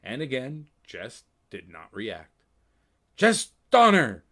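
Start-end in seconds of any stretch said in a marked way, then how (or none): 3.2-4.2 loud, high call of his name; a sudden loud yell from the orb. Acted. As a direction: shout to break his focus. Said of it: "the image in the orb yelled".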